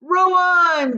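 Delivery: high, loud commanding shout; Wonka's shout. A narrator's reading. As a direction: shout it to the rowers. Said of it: "shouted Mister Wonka".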